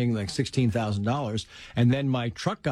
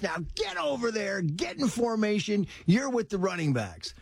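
stern coach; 8 semitones higher than usual. Retold or imitated. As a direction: bark the drill orders like a strict coach. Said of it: "and he goes".

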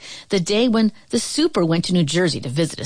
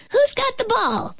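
put-on much higher kid's voice, asking eagerly; Chicky asking. A narrator's reading. Kid asking the others at the field, high-voiced. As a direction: call out like a young kid at the field. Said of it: "Chicky asked".

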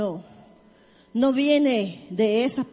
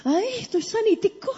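hammed-up delighted disbelief; acted amazed healed man; 10 semitones higher than usual. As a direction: play the lame man finding nothing hurts. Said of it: "dice que cuando ese se dio cuenta".

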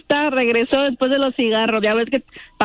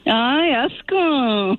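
long, drawn-out disgust; real drawling ew; much slower than usual. Real, not acted. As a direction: stretch it out slowly, revolted. Disgusted by caller.

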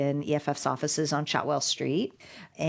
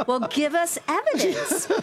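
high, laughing mock demand for proof; 11 semitones higher than usual. EFF staff pressing industry friends for proof, laughing. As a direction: toss it off with a laugh, half joking.

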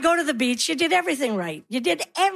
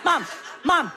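pestering son. Acted. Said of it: "He'd be like".

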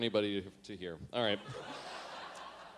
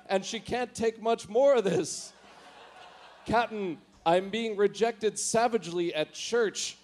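a lightly voiced, higher, urgent crew report to the captain; hammed-up Star Trek officer; 8 semitones higher, 4 dB louder than usual. Officer warning the captain of attack, mock urgent. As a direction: play it like a panicked Scotty without the accent. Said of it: "I can't do a Scotty accent".

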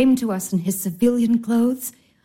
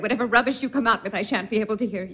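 hurried refusal; 4 dB quieter, faster than usual. Acted. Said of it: "I can't hear what you're saying".

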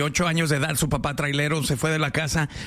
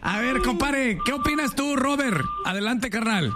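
radio call-out; 8 semitones higher than usual. Acted. Calling caller on air.

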